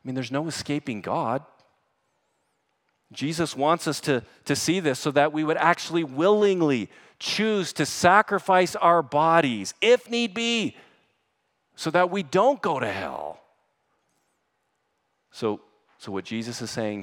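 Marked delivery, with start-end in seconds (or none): 3.1-9.7 loud, high, urgent pulpit exhortation; forceful preaching. Real, not acted. Preacher urging the church to fight sin, forceful. 9.8-10.8 impassioned cry. Real, not acted. Preacher pressing listeners to sacrifice, impassioned IF NEED BE. Same phrase, impassioned take. 11.7-13.4 loud, high, urgent pulpit exhortation; forceful preaching. Real, not acted. Preacher urging the church to fight sin, forceful.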